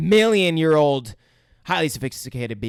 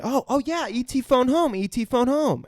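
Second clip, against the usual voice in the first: high, quicker excited repeating; Elliot; 10 semitones higher than usual. Retold or imitated. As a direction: catch on eagerly, mock-excited. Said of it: "Elliot gets it and he repeats it".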